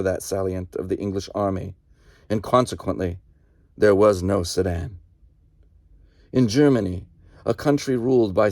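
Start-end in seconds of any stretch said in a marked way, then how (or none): none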